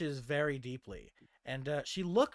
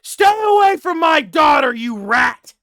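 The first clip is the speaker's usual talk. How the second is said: angry father bellowing, high and very loud; furious father shout; 10 semitones higher, 18 dB louder than usual. Acted. Father yells at his daughter's suitor from the door, furious shout. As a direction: roar it like an enraged, protective father. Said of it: "from the door, you hear".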